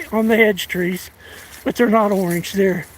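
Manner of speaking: ordinary delivery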